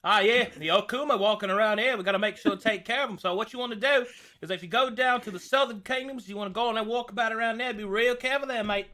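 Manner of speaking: high, fast, rambling Aussie advice; loud, matey Australian patter; Australian accent. Acted. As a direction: rattle it off as an Australian demon hunter. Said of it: "will just become Australian".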